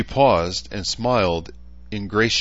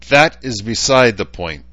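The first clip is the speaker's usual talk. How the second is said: growled; 5 semitones higher, 8 dB louder than usual. A narrator's reading. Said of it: "the council member growled".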